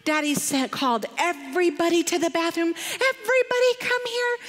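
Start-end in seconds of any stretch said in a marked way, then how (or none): 3.0-4.4 high-pitched summons to the household; father calling out. Retold or imitated. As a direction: call everyone over urgently, pitched up. Father calling everybody to bathroom.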